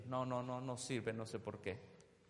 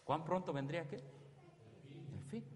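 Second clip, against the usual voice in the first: hushed question, whispered answer; 2 semitones higher, 6 dB quieter, slower than usual. Acted. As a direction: drop to a whisper on "El fin". (susurrando la respuesta)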